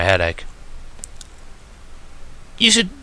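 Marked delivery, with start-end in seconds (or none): 2.6-2.9 teasing. A narrator's reading. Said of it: "winking at me".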